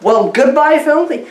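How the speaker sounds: ordinary delivery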